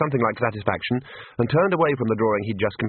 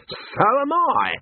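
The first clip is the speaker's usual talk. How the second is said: put-on hearty old wizard voice; much slower than usual. A narrator's reading. Arch-Chancellor's slow reply.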